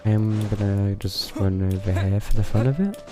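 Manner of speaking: normal talking voice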